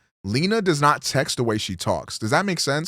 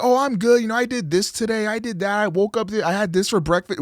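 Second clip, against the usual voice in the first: higher, fast, bubbly rundown of the day; chatty friend impression; 9 semitones higher, faster than usual. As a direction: mimic a chatty friend gushing about her day. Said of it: "if somebody says".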